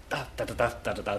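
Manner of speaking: "Da, da, da" tapped out softly in quick dactyls; dactylic rhythm. Shown as a demonstration. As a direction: beat out the rhythm da da da quietly. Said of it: "Fantastic clatter".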